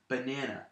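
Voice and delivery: "banana" with the middle syllable stressed. Shown as a demonstration. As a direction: say "banana" the standard way, leaning on NAN. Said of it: "two unstressed syllables and one stressed".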